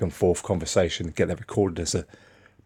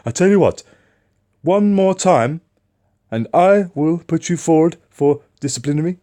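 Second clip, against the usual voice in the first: loud, much higher, mock furious boss; angry threat; 10 semitones higher, 6 dB louder than usual. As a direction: hammer the threat, slowing on one more time. Boss threatening disciplinary, retold.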